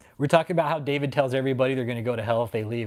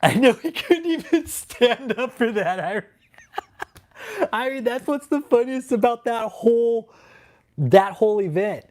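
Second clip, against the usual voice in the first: laughing; 8 semitones higher than usual. Real, not acted. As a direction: talk while cracking up. Laughing while recalling event.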